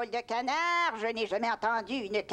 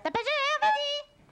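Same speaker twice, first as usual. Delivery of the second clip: shouting a command. Acted. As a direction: call out the order from afar. Calls the bird to drop water.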